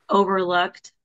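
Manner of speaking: normal talking voice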